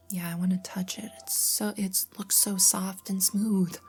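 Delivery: ordinary delivery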